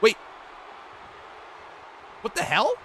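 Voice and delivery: loud, high gasp of disbelief; stunned by the move; shocked. Real, not acted.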